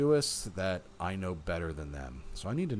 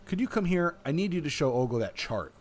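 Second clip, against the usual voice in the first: raised, higher calling voice at first; 7 semitones higher, 6 dB louder than usual. A narrator's reading. Guy asking Pat to come over, calling out. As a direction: lightly call someone over. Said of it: "called her from the gathering".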